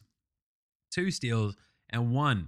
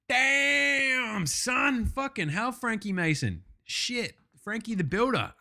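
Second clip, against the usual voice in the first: amazed exclamations; 9 semitones higher, slower than usual. Real, not acted. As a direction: gush over his big night. Amazed at player's night.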